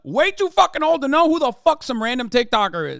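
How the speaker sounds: high, loud outraged rant; exasperated shout. Real, not acted. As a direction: yell it in disbelief. Ranting about the old man.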